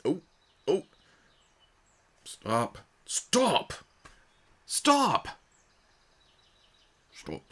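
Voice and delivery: high, rising cries of stop; pleading stop. Real, not acted. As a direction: beg the rolling ball to stop. Begging the ball to stop.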